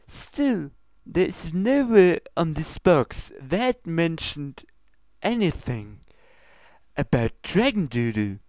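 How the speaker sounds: high, formal princess scolding; lofty royal reproach, pitched high. Acted. Luna scolds Pinkie about her ingredients, high and prim.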